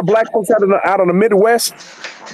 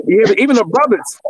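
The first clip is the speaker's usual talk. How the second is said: ordinary talk, nothing marked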